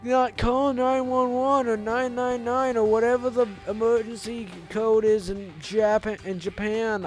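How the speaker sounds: high, slow dazed stoner voice. Acted. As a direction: drawl it out like a stoner. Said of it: "my stoner voice for you".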